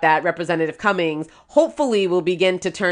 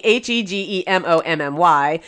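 ordinary talk, nothing marked

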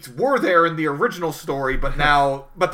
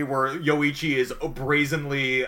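normal talking voice

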